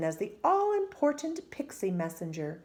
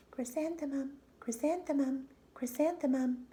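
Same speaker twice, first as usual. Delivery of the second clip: calling flowers; 7 semitones higher than usual. A narrator's reading. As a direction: let the flowers beckon dreamily. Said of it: "the flowers seemed to say".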